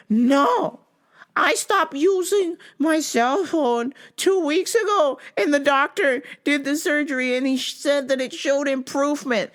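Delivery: mock old lady. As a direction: mimic the confused caller in a high voice. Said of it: "She goes".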